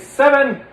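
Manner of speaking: "seven" punched high and loud, both syllables clear; emphasis on seven. Shown as a demonstration. Puppeteer demonstrating syllable count, "seven" high and emphatic in two beats. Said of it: "put the right emphasis".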